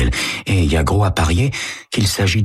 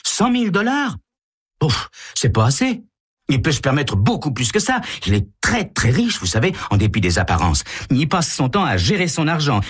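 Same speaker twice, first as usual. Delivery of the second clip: put-on much higher, scoffing teen voice; bratty rich girl; 10 semitones higher than usual. Carole scoffs at ransom.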